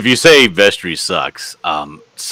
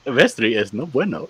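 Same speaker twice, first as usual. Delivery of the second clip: mock voice clip; 3 semitones higher, 8 dB quieter than usual. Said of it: "a voice clip just saying".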